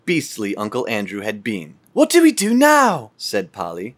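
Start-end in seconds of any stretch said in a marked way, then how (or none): 1.9-3.9 put-on high, light child's voice; young girl voice. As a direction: voice the children brightly.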